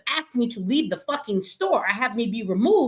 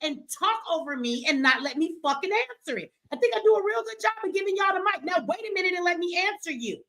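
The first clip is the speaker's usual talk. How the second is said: higher, sharp and insistent; irritated, cut off; 7 semitones higher than usual. Real, not acted. Host scolding cohost for interrupting, irritated and insistent. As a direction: stop them talking over you.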